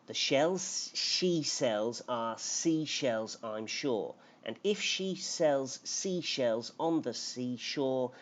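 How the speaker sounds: slow, deliberate tongue twister; slow careful take. Shown as a demonstration. Teacher's careful final attempt for the class, slow. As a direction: take each word carefully and slowly.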